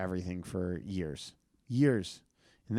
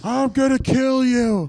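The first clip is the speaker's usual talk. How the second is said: much higher, louder, slow mock shriek; shrill threat; 14 semitones higher, 11 dB louder, slower than usual. Danny threatens the comedian, high screaming. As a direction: scream the threat like an unhinged stranger. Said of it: "It's Danny from Vero Beach".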